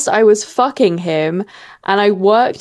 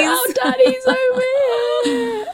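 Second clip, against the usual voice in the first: high fake sobbing; mock tearful pride; mock crying.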